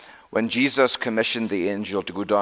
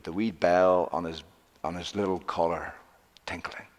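low, soft, hushed narration; hushed storytelling; 5 semitones lower, 8 dB quieter than usual. A narrator's reading. Preacher telling of the lost dog's return, hushed. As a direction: tell it softly and low, slowing towards the end.

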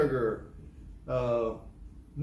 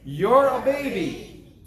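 very slow, loud, higher chant; call and response; 7 semitones higher, 7 dB louder, much slower than usual. Real, not acted. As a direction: drag it out for all to join. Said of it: "you're a baby if you don't say it with me".

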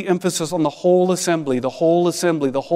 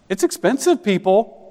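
mock complaint; 6 semitones higher than usual. Preacher joking about feast costs.

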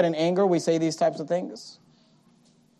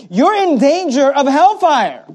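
preaching warning; 6 semitones higher, 6 dB louder, slower than usual. Acted.

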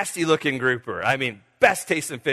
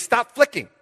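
sharp higher, louder order; captain's command; 8 semitones higher, 4 dB louder than usual. Retold or imitated. Captain barking new order at the fisherman, mock sharp. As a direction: snap the order like an impatient captain. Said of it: "and he says".

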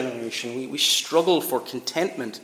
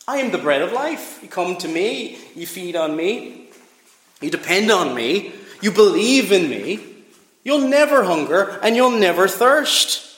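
rising into full-force preaching, higher and louder; 4 semitones higher, 3 dB louder than usual. Acted. Preacher drives home the bread of life claim, building louder. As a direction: build the claim to a ringing peak.

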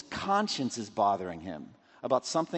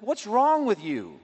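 indignant rebuke; 6 semitones higher than usual. Acted. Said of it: "It's like".

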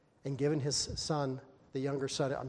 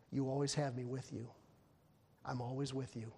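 softer, gentle reassurance; father quietly reassuring his elder son; tender father; 3 semitones lower, 9 dB quieter than usual. Acted. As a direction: speak it like a loving father.

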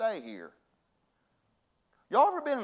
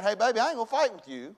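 imitating a firm, higher-pitched refusal; stubborn wife; 5 semitones higher, faster than usual. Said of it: "She was stubborn".

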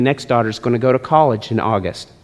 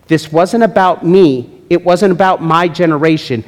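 ordinary talk

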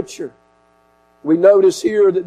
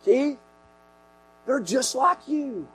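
much softer, sudden drop in volume; hushed rebuke; 6 semitones higher, 9 dB quieter than usual. Retold or imitated. The Lord reminding the preacher he is no different, hushed rebuke. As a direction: quietly bring the reproof home. The Lord's gentle rebuke, retold.

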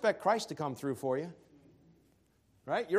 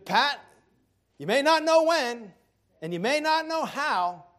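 raised pleading; 7 semitones higher, 4 dB louder than usual. Real, not acted. Pleading with congregation.

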